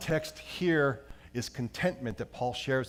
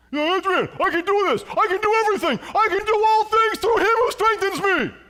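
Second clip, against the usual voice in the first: much higher, loud hammed-up boxer shout; Rocky impression; 12 dB louder than usual. Pastor doing a loud, hammed-up Rocky. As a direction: pump yourself up like Rocky. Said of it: "trying to portray Rocky".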